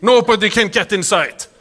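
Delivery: high, loud, hammed-up bragging; imitating an over-confident homeowner; boastful homeowner. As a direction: boast about an impregnable house, mock-proud. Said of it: "can you imagine somebody telling you".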